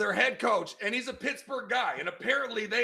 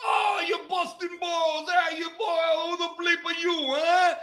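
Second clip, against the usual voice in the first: high-pitched hammed-up New Yorker; mock New York accent; 8 semitones higher than usual. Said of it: "if I can make fun of the".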